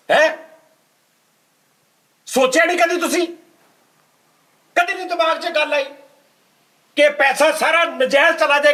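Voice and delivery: high-pitched, outraged questions; incredulous scolding. Acted. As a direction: pile on the disbelief, pitch way up. Host scolds the selfie donor.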